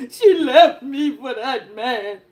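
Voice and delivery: hammed-up sob-laugh, slowed; mock crying man. Mocking a dumped man crying, hammed-up sob. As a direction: blubber it, half laughing. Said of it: "he just crying".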